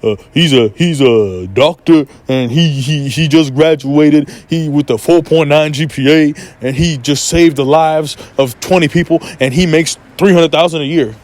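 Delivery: a hint of a boastful parent showing off a son; bragging parent. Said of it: "show them around your friends".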